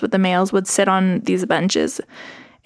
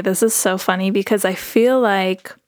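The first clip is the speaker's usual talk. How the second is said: ordinary delivery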